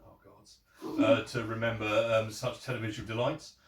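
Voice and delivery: normal talking voice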